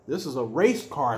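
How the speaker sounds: ordinary delivery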